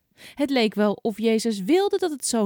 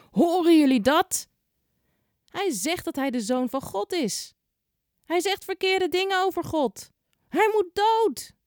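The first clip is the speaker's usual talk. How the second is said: outraged shout; 10 semitones higher than usual. A narrator's reading. High priest to the crowd, an outraged high shout. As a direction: whip up the crowd against him. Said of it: "beginnen te schreeuwen".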